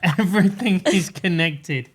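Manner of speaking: slow, drawn-out mimicry; mock conspiracy theorist. Co-host teasing her as a conspiracy theorist, slow mock revelation. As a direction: echo it slowly like a grand revelation. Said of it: "Now I sound like one of you all lot".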